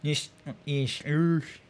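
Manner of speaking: "ish" slurred through stuck-out teeth. Shown as a demonstration. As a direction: push "ish" out mushy, teeth forward. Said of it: "with this, like".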